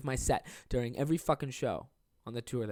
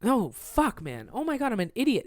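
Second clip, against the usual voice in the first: exasperated at himself; 10 semitones higher, 3 dB louder than usual. Real, not acted. Catching his own date mistake.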